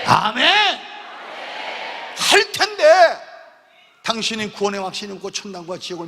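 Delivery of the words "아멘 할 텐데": high, loud, drawn-out amen; a shouted amen rising high and slow; loud amen call. Real, not acted. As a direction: call out the amen and stretch it slowly.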